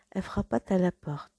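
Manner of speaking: ordinary delivery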